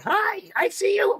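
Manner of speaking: very high, slow sing-song peekaboo voice; playful baby talk. Retold or imitated. Grandpa playing with toys for his granddaughter, very high cooing voice. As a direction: coo it like a toy popping round a corner. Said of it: "coming around the corner going".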